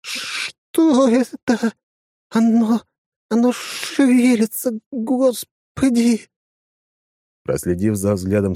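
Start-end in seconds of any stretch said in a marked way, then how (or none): 0.0-6.3 very slow, high terrified woman. A narrator's reading. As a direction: gasp it out in horror. Woman sees corpses moving.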